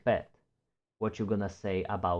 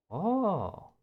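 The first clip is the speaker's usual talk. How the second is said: surprised oh; 10 semitones higher than usual. Real, not acted.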